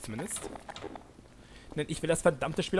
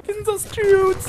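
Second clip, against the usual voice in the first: silly voice; 8 dB louder, slower than usual. Acted. Silly high exclamation.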